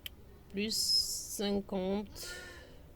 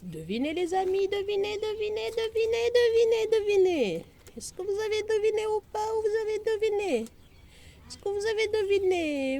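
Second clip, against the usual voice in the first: much higher, giddy, rapid-fire teasing; can't contain the excitement; overexcited; 12 semitones higher, 3 dB louder than usual. Real, not acted. Vlogger too excited to calm down, teasing viewers to guess. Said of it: "Faut que je me calme".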